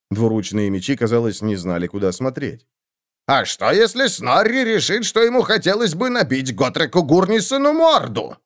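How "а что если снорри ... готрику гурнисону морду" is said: high, defiant dwarf; Snorri's voice. A narrator's reading. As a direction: push back with simple defiance. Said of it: "спросил Снорри".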